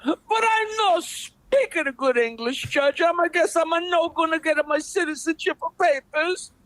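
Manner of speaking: put-on broken English, high and pleading in an Italian accent; pleading Italian immigrant. Joke: immigrant pleads to judge.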